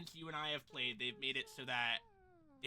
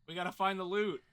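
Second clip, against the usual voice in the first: excited; 3 semitones higher, 11 dB louder than usual. Real, not acted. Urging team on.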